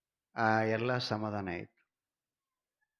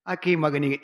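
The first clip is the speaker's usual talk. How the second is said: ordinary talk, nothing marked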